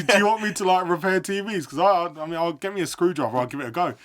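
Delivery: high, loud, exasperated mock question; incredulous. Nephew to uncle about the TV, high, loud and incredulous.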